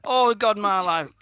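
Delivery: high, loud theatrical lament; exasperated despair. Real, not acted. Host despairs at co-host's reality TV news, loud exasperated wailing. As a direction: wail as if life is over.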